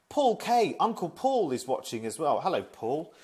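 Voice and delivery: high, loud call of a name; delighted greeting. Real, not acted. Greeting an old colleague watching the stream, delighted and loud. Greeting a viewer who joined.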